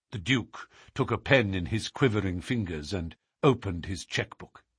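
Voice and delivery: usual speaking voice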